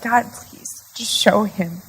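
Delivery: hushed, pleading prayer; soft plea. Retold or imitated. Wife praying that God show her husband, softly pleading. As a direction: drop the voice and plead gently.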